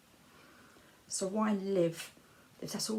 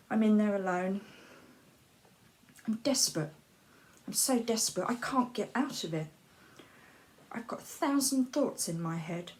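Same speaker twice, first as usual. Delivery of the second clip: ordinary delivery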